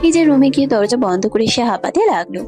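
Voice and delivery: ordinary talk, nothing marked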